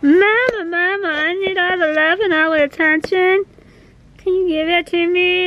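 high, whiny needy pet voice; needy cat voice. Retold or imitated. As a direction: play the clingy cat begging. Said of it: "He's all like".

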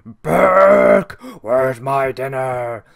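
booming, slow monstrous bellow from upstairs; monster master's demand. Retold or imitated. Master upstairs summons Burke for dinner, booming slow monster voice. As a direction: roar for your dinner like a hidden beast. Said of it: "who will then go".

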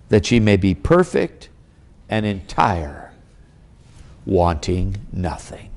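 put-on measured, weighty reading of old scripture, slowing and dropping at the end; solemn scripture reading. Preacher reading James aloud to the congregation, solemn and measured. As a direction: lift the King James words up, then let them settle.

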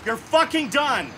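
ordinary talk

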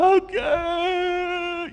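put-on high, whiny child giving in; sulky child voice. Preacher voicing his kid finally agreeing to bed, sulky. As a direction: drag it out like a pouting kid.

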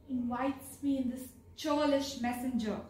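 ordinary talk, nothing marked

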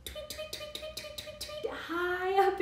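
high, soft tweeting; teacher tweeting like a bird for children; bird tweets. Shown as a demonstration. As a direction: chirp it high like a little bird. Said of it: "Most birds make treble sounds".

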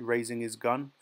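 ordinary delivery, nothing marked

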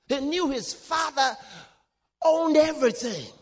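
high-pitched, impassioned pulpit delivery; fervent preaching. Real, not acted. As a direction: preach it with rising fervour to the congregation. Preaches fervently on Abraham's inheritance.

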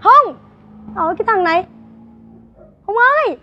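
high, loud calls after him; calling out. Acted. A mother shouting after Hùng as he leaves. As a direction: shout his name as he walks off.

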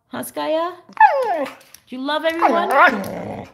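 put-on high, bright baby voice to the dog; pet talk. Owner and dog signing off to viewers, high cooing pet talk. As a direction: coax the dog to wave bye like a toddler.

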